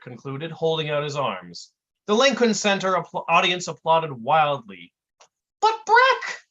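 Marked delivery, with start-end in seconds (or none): 5.6-6.4 very high, baffled voice; Dummerton. A narrator's reading. Sidekick puzzled after the story, high baffled voice. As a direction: pitch it sky-high, puzzled. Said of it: "said Dummerton with a baffled expression".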